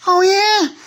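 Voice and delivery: very high, squeaky "Oh, yeah?" dare; squeaky taunting toy. Pretend. One toy squeaking a dare at the train. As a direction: squeak it out like a cheeky little toy picking a fight. Toys quarrel in pretend play.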